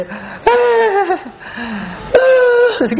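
exaggerated cries of labour pain; mock labour groans. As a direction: wail like a mother giving birth. Mother in labour acted out.

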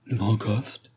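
usual speaking voice

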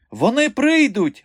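high, loud, drawn-out fluster. A narrator's reading. Circus directress not knowing where to begin, flustered. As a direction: cry it out in a tizzy. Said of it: "метушилася директриса".

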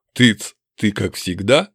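slow, low orc question; orc brute. Acted. Orc asking his elf friend, slow and low. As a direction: ask it slowly in an orc voice.

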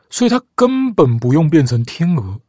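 usual speaking voice

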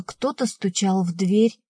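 usual speaking voice

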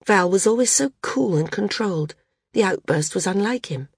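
usual speaking voice

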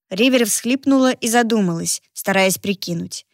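usual speaking voice

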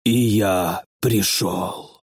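slow, low voice echoing from a pipe; an alien voice that chills to the bone; inhuman voice. Acted. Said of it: "чужой нечеловеческий голос".

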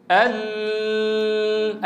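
"ال" with the lam held long; stretched lam. Shown as a demonstration. Teacher testing whether lam can be stretched, holding "ال" long. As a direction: draw out "ال" as far as it goes. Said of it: "جرب أن نمطه".

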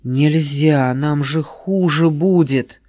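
plain reading voice

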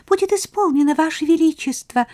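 very high squeaky servant mouse; tiny mouse voice. A narrator's reading. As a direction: squeak it like a little maid. Lady-in-waiting mouse.

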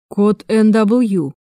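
slow, deliberate incantation. A narrator's reading. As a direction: repeat it slowly like a spell. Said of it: "повторил как заклинание Крейг".

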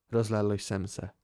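ordinary delivery

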